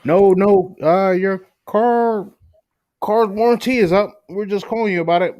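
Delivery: a hint of a pushy sales caller; imitating a warranty caller. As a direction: pitch the fake car warranty. Car warranty caller voiced.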